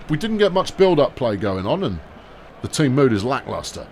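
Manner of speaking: ordinary delivery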